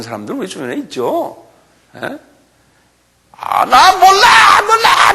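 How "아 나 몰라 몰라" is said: very high, drawn-out wailing shout; frantic denial. Acted. Peter keeps denying as the cock crows, frantic high shout. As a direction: wail the denial loudly, stretching it. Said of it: "막 이러고 있는데".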